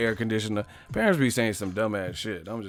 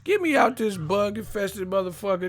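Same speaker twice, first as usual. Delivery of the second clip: very high mock freak-out; exasperated, high-pitched demand to stop; breaking character; 8 semitones higher than usual. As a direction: imitate a reporter ranting at the crew. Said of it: "that motherfucker switched up real quick".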